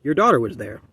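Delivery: put-on plainly stated old man's line; matter-of-fact. A narrator's reading. Old man turning toward the father, matter-of-fact. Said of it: "said matter-of-factly".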